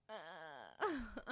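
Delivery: low, soft, dragged-out "Uh"; dragged drawl. Retold or imitated. Host mimicking her own dragged words, drawn out. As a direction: imitate a lazy dragged "Uh". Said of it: "the way that you drag your words".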